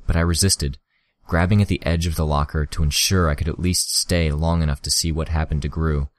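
ordinary delivery

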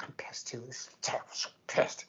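hushed, whispery seething; mock-mad whispered venting; retold anger. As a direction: imitate someone fuming under their breath. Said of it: "she was mad with me".

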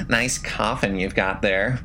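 high, sing-song creepy greeting; eerie woman voice. A narrator's reading. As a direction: greet him high and teasing. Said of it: "Elsie voiced, laughing".

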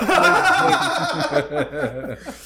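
high burst of laughter; real laughter breaking out; laughing. Real, not acted. Cracking up at his own story, high laughter.

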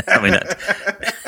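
talking through a laugh, slow and high; can't finish for laughing. Real, not acted. Laughing at the story.

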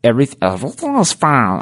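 a lightly voiced duck squawk, insisting he's fine; half-hearted cartoon duck impression, strained calm; sheepish attempt at a cartoon duck, wavering; Donald Duck voice. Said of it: "I can't do him really well".

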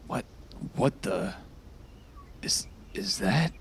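halting, half-whispered bewilderment; hushed disbelief. Acted. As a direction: trail into a whisper as you peer closer. Peers closely at moving blood.

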